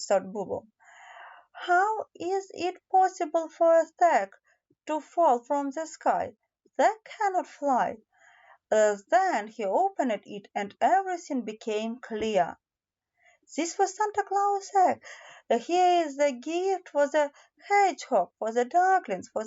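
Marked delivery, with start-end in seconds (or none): 1.5-8.0 put-on higher, wondering child voice; lightly raised voice of a puzzled young hedgehog; puzzled little hedgehog. A narrator's reading. Bobo puzzles over the sack. 13.6-15.0 higher, marvelling reveal; a touch of childlike amazement; delighted discovery. A narrator's reading. Bobo discovers Santa's sack.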